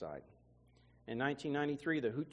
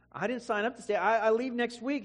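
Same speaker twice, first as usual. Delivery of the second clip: startled protest; 7 semitones higher, 4 dB louder than usual. Retold or imitated. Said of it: "And I said".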